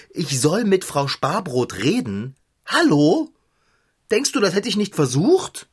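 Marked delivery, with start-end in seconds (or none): 2.6-5.7 exasperated. Acted. Fahrradschrauber to the cat, exasperated. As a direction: fling it back in disbelief.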